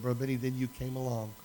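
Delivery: a hint of a crying woman, moved; woman thanking the pastor through tears, understated; retold weeping. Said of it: "she's just weeping profusely".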